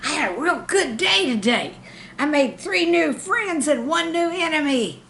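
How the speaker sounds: put-on a kid gushing about his first school day; excited. Boy telling mom in the car, excitedly. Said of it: "He said quite excitedly".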